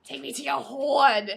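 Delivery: loud, slow, laughing plea; mock surrender. Cohost jokingly begging the zombies, loud and slow. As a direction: throw yourself at the zombies, hammed up.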